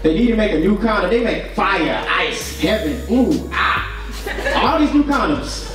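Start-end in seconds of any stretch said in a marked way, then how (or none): none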